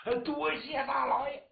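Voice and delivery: high, eager bow of thanks; grateful thanks. Acted. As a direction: thank the lord in relief. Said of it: "那你回家吧". (高声感激道谢)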